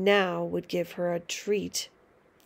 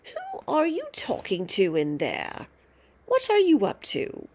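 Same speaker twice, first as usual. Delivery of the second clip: put-on shrill old woman calling from afar; screechy voice; 4 semitones higher than usual. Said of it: "came the screechy voice from the next room".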